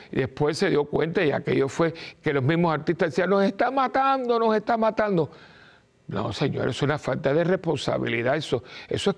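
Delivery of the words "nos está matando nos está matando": mock alarmed artists. Artists complaining about deaths.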